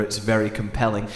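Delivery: ordinary talk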